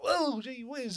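high-pitched mock astonishment; mock city slicker. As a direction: imitate a wide-eyed city slicker marvelling. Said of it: "you had some city slickers".

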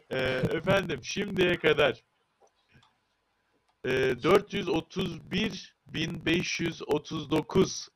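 ordinary talk, nothing marked

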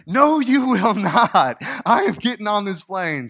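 high, loud and slow, word by word; stranded traveller refusing the gate agent, loud and high; furious refusal. Retold or imitated. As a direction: plant your feet and refuse. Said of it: "And she was mad".

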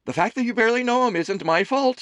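high, loud doting mother voice; a fussing mother teasing her son; Amelie's voice. A narrator's reading. Mother serving her son lunch, high and fussing. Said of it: "she declared".

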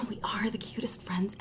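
soft whispered agreement; whispering. Real, not acted. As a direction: breathe it, barely voiced. Whispered reply after sigh.

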